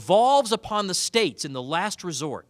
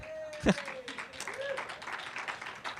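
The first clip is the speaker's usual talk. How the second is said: laughing; 10 dB quieter than usual. Real, not acted. Laughs at own joke.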